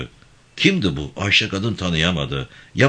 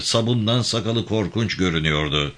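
plain reading voice

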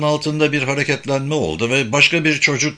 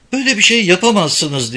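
higher, raised protesting yell; shout; 8 semitones higher, 3 dB louder than usual. A narrator's reading. Woman at the front door protesting to Eric and Yuna, higher indignant shout. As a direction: cry out in outrage from the doorstep. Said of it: "diye bağırdı".

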